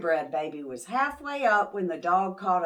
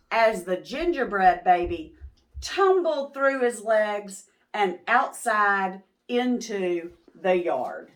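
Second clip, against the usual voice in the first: plain reading voice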